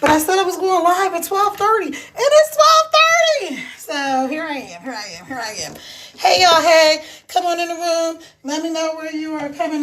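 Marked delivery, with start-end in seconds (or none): 2.0-3.6 high, slow and squealing; excited squeal. Real, not acted. Host tells viewers it's already twelve thirty, squealing. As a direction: squeal it out like you're amazed at yourself.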